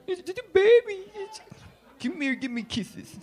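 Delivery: high, cutesy mock cooing; baby talk. Cooing for kisses from a baby, high baby-talk voice.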